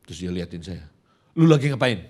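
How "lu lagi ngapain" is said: loud, curt mock challenge; snappish father. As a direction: imitate a brusque dad demanding to know. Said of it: "Dia orangnya nyolot begitu".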